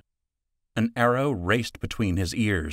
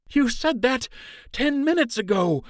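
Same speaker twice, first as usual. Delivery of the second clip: panting. Acted.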